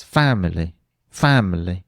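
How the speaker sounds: family with FA stressed and the last two syllables nearly dropped; stressed first syllable, swallowed ending. Shown as a demonstration. Teacher showing family is still clear with the stress right. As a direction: hit FA in family and swallow the rest. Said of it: "the last two syllables I'm almost not saying".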